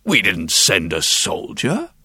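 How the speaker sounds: low, flat official denial; lightly voiced stern official; stiff ceremonial announcing. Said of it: "said the chamberlain".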